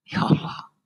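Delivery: breathy whisper of disbelief; whispered dismay. Retold or imitated. Father despairing at son's answer, whispered sigh.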